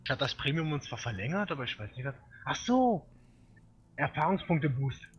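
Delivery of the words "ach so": realization. Real, not acted. Sudden realization.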